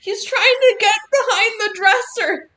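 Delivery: high cooing pet voice. Real, not acted. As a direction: coo at the cat. Cooing at the cat.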